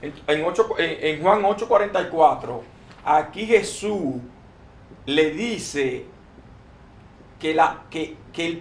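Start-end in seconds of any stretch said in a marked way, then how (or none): none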